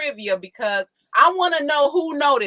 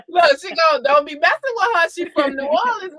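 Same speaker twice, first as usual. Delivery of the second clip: laughing; 6 semitones higher, 5 dB louder than usual. Real, not acted. Still laughing after the flirt voice.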